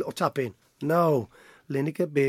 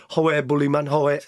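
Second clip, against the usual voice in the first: mock Geordie, a little louder; bad Geordie accent; 4 dB louder than usual. Gazza answering his teammate at half time, mock Geordie accent. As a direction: put on a rough attempt at Geordie. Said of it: "That's a shit Geordie accent".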